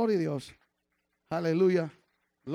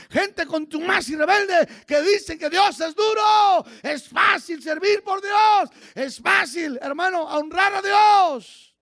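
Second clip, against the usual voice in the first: loud, rising sermon delivery; fiery pulpit preaching; full-force preaching; 12 semitones higher, 11 dB louder than usual. Acted. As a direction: preach it hard to the congregation.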